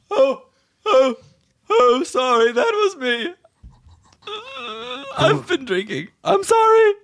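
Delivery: very high, tipsy, near tears; hiccuping apologetic guest; drunk party guest. Acted. Drunk guest apologizing to Snack, high weepy voice. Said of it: "you hear".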